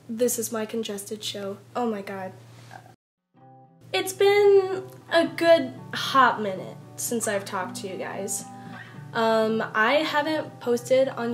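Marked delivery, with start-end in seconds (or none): none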